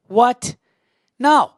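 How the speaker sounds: mock disbelief into the phone; fake phone call. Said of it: "That's how I said".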